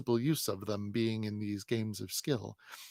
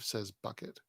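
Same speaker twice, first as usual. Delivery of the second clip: plain reading voice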